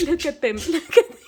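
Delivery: can't stop giggling while pointing them out; laughing. Real, not acted. Owner laughing at her birds scattering from Sonny, laughing.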